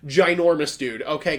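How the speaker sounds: ordinary delivery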